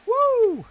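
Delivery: shrill high-pitched woo shout; high falsetto woo. Pretend. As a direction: belt a high woo like the entrance theme. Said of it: "I'm not doing it anymore".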